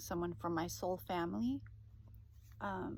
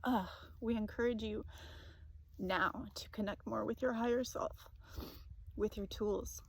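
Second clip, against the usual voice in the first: channeled message reading. Acted. Reading a spirit guide's message to viewers, soothing. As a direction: give it calmly, like a gentle oracle.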